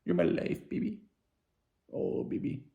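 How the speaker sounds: put-on breathy high whispered baby talk; hushed mock-romantic whisper to a partner; mushy whisper. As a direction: coo it like a lovesick sap. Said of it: "You're like".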